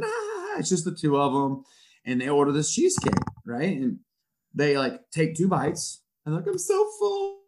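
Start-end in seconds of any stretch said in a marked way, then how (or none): 0.0-1.6 mock giggling girls. 6.5-7.4 high, mock-dainty girl voice; guy imitates teen girls who left their cheesecake, high and prissy; mimicking dainty girls. Quoting high school girls.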